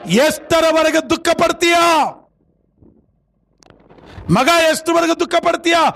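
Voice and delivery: sustained high sermon cry, endings stretched; forceful preaching. Acted. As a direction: exhort the congregation point by point. Preaches question to congregation. (ಏರುದನಿಯ ಪ್ರವಚನ)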